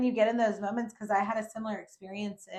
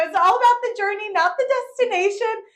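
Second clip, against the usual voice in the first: high loud fast mock-wise saying; smug proclamation; 13 semitones higher, 13 dB louder than usual. Showing off on the rocks, high smug proclamation. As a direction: preach it like a guru.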